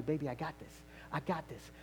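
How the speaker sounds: low, hushed and steady; calm them with quiet certainty; soft divine reassurance. Acted. God comforting the believer, softly reassuring. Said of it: "He's telling you".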